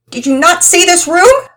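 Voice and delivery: indignant, tearful outburst; wailing at the mess; upset girl complaining. Acted.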